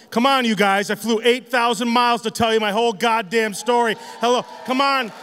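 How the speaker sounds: ordinary delivery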